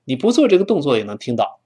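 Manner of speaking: ordinary delivery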